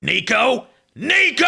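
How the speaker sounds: rising from a call into a high loud shout; Snake game over shout. Pretend. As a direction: build from a call to a desperate scream. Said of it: "Say Nico in Snake's voice".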